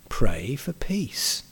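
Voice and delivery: slow, hushed whisper; whispered prayer. Real, not acted. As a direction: breathe the words slowly, almost silent. Sermon climax, quiet plea.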